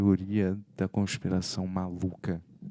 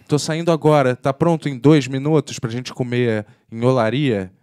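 loud, higher mock call to hurry; last-minute summons; 4 semitones higher, 9 dB louder than usual. Friend springing a dinner invite, loud and urgent. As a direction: imitate an urgent friend, pushing the volume up.

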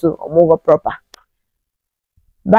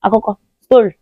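normal talking voice

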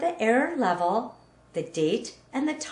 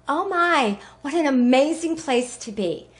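excited; 5 semitones higher, 4 dB louder than usual. Acted. Gushing at the Eiffel Tower.

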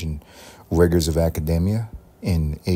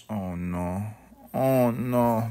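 dismayed oh no; 3 semitones higher, 5 dB quieter, much slower than usual. Real, not acted. Just broke a plate.

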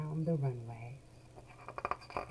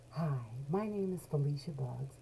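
ordinary delivery, nothing marked

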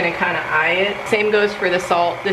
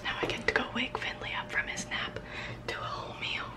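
whispering; 5 semitones higher, 13 dB quieter than usual. Real, not acted. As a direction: breathe the words, no voice. Child napping nearby.

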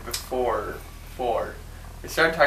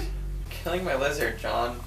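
ordinary delivery

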